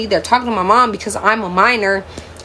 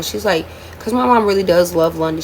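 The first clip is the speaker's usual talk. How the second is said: ordinary talk, nothing marked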